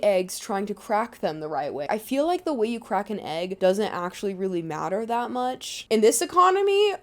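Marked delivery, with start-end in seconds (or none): 5.9-6.9 high, loud joking disbelief; hammed-up incredulous question; mock outrage. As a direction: exclaim in pretend shock at the waste. Joking outrage over wasted eggs.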